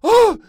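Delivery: sudden very high loud shriek; startled scream. Acted. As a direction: scream at the jump. Animal jumps out.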